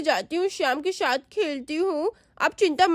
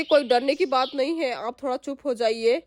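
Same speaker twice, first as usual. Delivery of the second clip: plain reading voice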